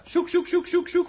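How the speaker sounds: high coaxing dog call. Acted. Crewman urges the dog to search, high coaxing call. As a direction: chirp it up like urging a pet.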